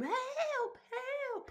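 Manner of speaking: mock scream. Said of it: "screaming in her room".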